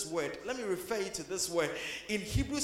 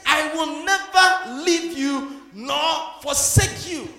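louder, higher, slow, weighing each word; slow emphatic preaching; 7 semitones higher, 11 dB louder, much slower than usual. Acted. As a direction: proclaim it slowly like a promise. Preaching the promise at full force.

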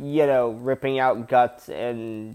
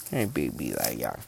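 much lower, softer coo to the dog; 14 semitones lower, 9 dB quieter than usual. Real, not acted. Owner cooing low and soft to his dog Leia. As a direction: croon it down low like to a puppy.

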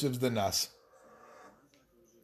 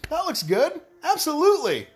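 high, eager gush over a new buy; hammed-up impulse buyer, high and excited; excited fanboy voice; 4 dB louder than usual. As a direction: squeal it like a kid spotting a toy. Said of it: "But I was like".

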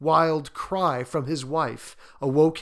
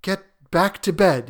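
shaken, slightly raised voice; a touch of alarm in an old man; unsteady; 5 semitones higher, 6 dB louder than usual. A narrator's reading. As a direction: order it with uncertain firmness. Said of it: "he said unsteadily".

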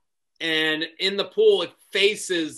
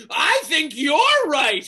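higher, louder, slow shout; mock shouted agreement; 9 semitones higher, 6 dB louder, slower than usual. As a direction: yell it right back. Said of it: "being like".

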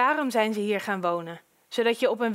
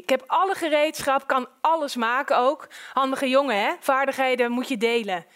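lively, slightly raised hearty chatter; chummy boastful neighbour; 2 semitones higher than usual. Acted. Handy neighbour offers the newcomers his tools, chummy. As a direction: pile on the neighbourly help with gusto.

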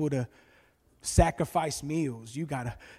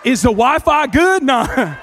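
loud, high mock shout; belted out for laughs; shouted punchline; 8 semitones higher, 15 dB louder, slower than usual. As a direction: holler the question with a big drawn-out finish. Acted joke punchline to bro.

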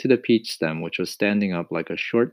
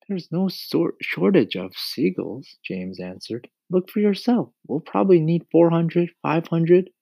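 put-on boy voice; 8 semitones higher, 5 dB louder than usual. A narrator's reading. James explains plan.

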